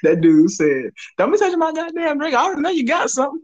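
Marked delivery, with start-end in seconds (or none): 1.2-3.4 high, fast mock shout; mock customer accusing the worker of tampering; imitated angry accusation. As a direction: jab it out with suspicion. Quoting dude accusing worker.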